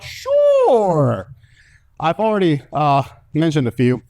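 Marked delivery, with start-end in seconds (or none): 0.0-1.3 mock agreeable. Answering his own hypothetical crowd question.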